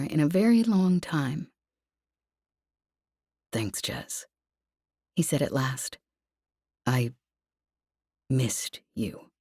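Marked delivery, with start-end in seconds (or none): 3.5-4.3 lower, near-whispered gratitude; hushed thanks. Acted. Lev moved, quiet. 6.8-9.3 lower, soft male voice; tender and quiet; Lev, tender. Acted.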